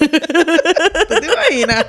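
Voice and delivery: laughing through the words; giggling question, slow and loud. Real, not acted. Laughing at the drinking joke.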